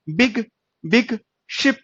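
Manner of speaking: slow, careful बिग शिप with short i; slow model words. Shown as a demonstration. Teacher modelling short i words slowly for students. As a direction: sound out बिग शिप syllable by syllable. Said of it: "इस तरह से".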